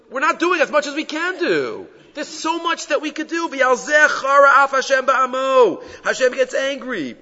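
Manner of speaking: high, impassioned preaching; fervent exhortation. Real, not acted. Preacher warning that Hashem gets angry, fervent exhortation.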